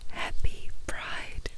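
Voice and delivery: ordinary delivery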